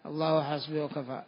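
ordinary talk

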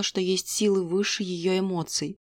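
usual speaking voice

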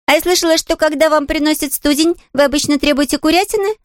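put-on high, innocent child's voice; puzzled little girl.

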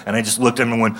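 ordinary talk

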